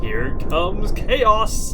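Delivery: loud, slow villainous proclamation; triumphant declaration. Pretend. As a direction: proclaim doom like a supervillain.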